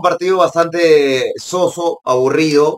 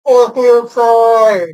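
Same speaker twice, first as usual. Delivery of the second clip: drawn-out frustration; 6 semitones higher, 5 dB louder, much slower than usual. Real, not acted. Penalty cancelled for offside.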